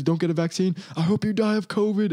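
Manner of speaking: mocking quote. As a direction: throw their words back with scorn. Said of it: "Joe Rogan says".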